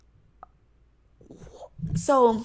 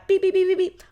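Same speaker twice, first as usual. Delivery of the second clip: imitated dial beeps; 11 semitones higher, 5 dB louder than usual. Imitating phone dial tones.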